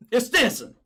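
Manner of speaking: loud, high, garbled self-introduction; Stinson impression. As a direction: blurt the name hard and mushed together. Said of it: "That's how he talks, right?".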